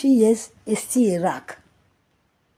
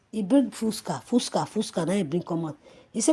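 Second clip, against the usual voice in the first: ordinary delivery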